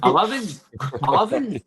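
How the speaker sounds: hammed-up repeat, pitched right up; mock calling out. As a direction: call it out high for someone who can't hear. Jokingly repeating for someone who missed it.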